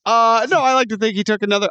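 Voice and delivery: normal talking voice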